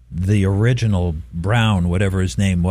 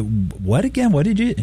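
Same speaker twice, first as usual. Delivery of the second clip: teacher's query; 8 semitones higher than usual. Retold or imitated. Teacher pressing student to repeat.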